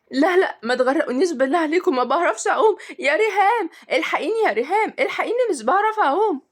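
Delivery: high, urgent cry for help; frightened pleading. A narrator's reading. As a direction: plead in rising panic, without shouting. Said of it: "وقعدت تصرخ شهد جدًا وقالت بخوف".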